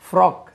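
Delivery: ஃப்ராக் as English "frock", short rounded o; teacher clearly modelling ஃப்ராக் as "frok"; correct pronunciation. Shown as a demonstration. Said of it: "உச்சரிப்பை கவனிங்க".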